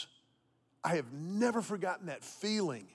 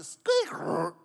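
imitated car noise; 4 dB louder than usual.

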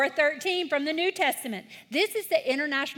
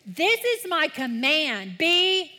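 leading recitation; 3 semitones higher, 4 dB louder, slower than usual. Shown as a demonstration. Children's pastor leading the group reading, loud and slow. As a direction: pull the kids into the verse. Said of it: "Read the highlighted words with me".

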